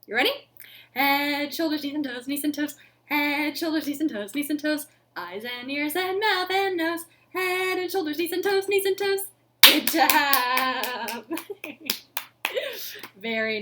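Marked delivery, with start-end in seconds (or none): 0.9-9.2 super fast. Shown as a demonstration. Teacher leads the super fast round for the kids. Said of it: "You ready for super fast?". 9.6-13.6 laughing praise. Real, not acted. Praising kids after fast song.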